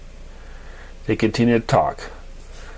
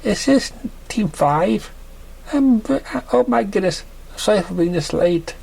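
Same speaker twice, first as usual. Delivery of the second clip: flustered late mare; 8 semitones higher than usual. Acted.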